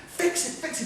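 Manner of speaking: high mock yell; retold screaming. Officer screaming at the crew to fix the tire, high yell. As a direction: bark the order, high. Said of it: "He's screaming".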